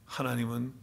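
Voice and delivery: ordinary talk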